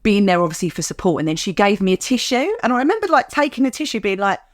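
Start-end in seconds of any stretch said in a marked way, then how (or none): none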